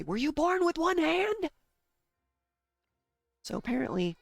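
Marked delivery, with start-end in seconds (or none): 0.0-1.5 high, loud, slow sneering question; mocking taunt. Father-in-law taunting her about her hand with a high, loud, slow sneer. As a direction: sneer it down at the kid. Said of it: "He'd hold up his stub and say".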